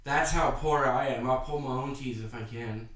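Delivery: ordinary talk, nothing marked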